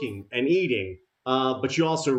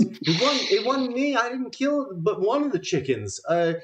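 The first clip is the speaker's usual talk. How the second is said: reluctant denial; 8 semitones higher than usual. Acted. Abigail to her husband, reluctantly blaming the heroes. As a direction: pop up and deny it with reluctance. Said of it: "she sort of says it reluctantly".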